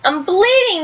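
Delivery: a light mock yell; mild yell. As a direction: cry out at the blood. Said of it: "the fox yelled".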